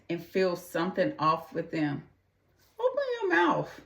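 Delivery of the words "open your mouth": urgent plea. Real, not acted. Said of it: "urging listeners".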